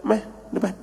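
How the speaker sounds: soft, understated call forward; retold quiet summons. Teacher calls Nyum to the front, quietly retold. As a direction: imitate a teacher beckoning under the voice.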